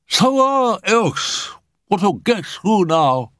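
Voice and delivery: very high, drawn-out spirit voice; slow, high-pitched announcement from the spirit world; Shiramako. A narrator's reading. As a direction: pitch it way up and stretch the words slowly. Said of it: "announced Shiramako".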